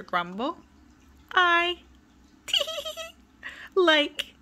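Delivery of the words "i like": high giggles bursting between the words; can't get the line out for giggling. Acted. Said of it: "giggled Miss Giggles".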